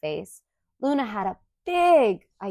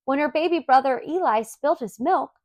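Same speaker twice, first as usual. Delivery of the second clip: ordinary delivery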